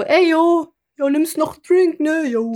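slow laid-back mock bro voice; laid-back yo-talk; chummy slang waiter.

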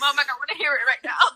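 fast, high, laughing brush-off; teen snapping at mum while laughing; laughing retort. Retold or imitated. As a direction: wave mum off mid-laugh.

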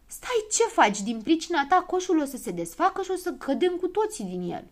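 Știe Tot scolding Probabil for cutting the basket; angry snap. A narrator's reading. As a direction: stop him with an angry cry. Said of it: "și se răsti".

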